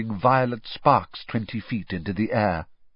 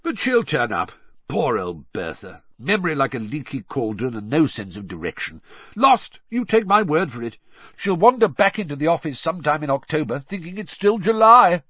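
breezy jovial chat, rising in pitch; carefree, building to hearty amusement; comfortable, unconcerned; 5 semitones higher, 5 dB louder than usual. A narrator's reading. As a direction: wave the worry away. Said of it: "said Bagman comfortably".

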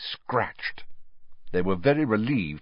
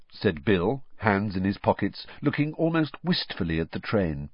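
plain reading voice

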